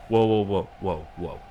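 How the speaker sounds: loud burst of urgent whoas, easing off; startled "whoa, whoa"; alarmed. Acted. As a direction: halt someone armed, urgently. Alarmed at character holding gun.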